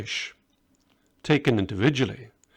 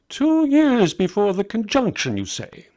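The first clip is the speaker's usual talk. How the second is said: Balthazar's voice; 9 semitones higher, 4 dB louder than usual. Acted. Old scholar Balthazar asks.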